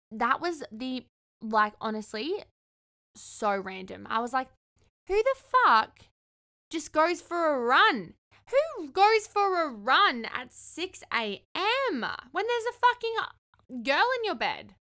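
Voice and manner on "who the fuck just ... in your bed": high, loud, drawn-out outrage; incredulous outburst. Retold or imitated. Woman ranting about a date's morning run, loud incredulity. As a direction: wail the question in exaggerated disbelief. Outraged disbelief at his leaving.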